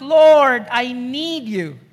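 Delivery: loud cry of Lord, then slow plea. Acted. Desperate mother cries out to the Lord, loud then pleading. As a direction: call out Lord, then beg. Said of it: "She's really crying out".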